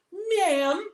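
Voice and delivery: mock-sweet, syrupy cop voice; overly nice officer, sickly sweet and slow; a fake-polite cop, slow and saccharine; sugary polite cop. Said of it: "the nicest peachy person ever".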